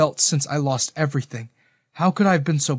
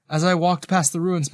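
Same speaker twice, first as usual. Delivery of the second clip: ordinary delivery, nothing marked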